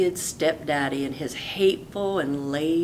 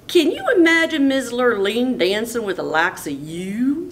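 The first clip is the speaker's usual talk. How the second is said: higher, louder taunt; mocking brother; 6 semitones higher, 4 dB louder than usual. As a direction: scoff at the very idea. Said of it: "said Milton".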